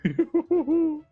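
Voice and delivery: high theatrical villain cackle; a sinister barber's delighted cackle; evil cackle. Acted. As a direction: cackle like a gleeful storybook villain. Villain cackles over drawing.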